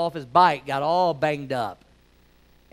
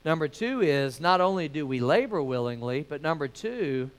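ordinary delivery

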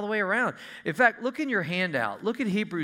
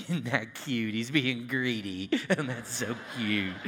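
doting parent; 5 semitones lower than usual. Retold or imitated. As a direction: coo it like a parent charmed by a greedy kid. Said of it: "And we go".

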